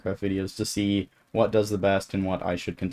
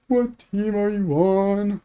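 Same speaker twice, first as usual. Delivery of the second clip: high, drawn-out mock-scared kid voice; imitating terrified kid; 11 semitones higher, slower than usual. Small kid challenging a much taller camper in the woods, mock-terrified. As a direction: squeal it slowly like a frightened little kid. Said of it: "already terrified of me".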